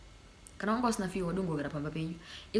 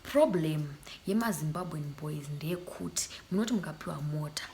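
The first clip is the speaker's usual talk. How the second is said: whisper. Real, not acted. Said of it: "I have to speak in Shona".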